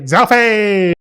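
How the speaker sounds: loud, high call of the name Zalfie. Shown as a demonstration. Streamer hollering the chat name Zalfie. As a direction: shout the name across the room. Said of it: "I can say".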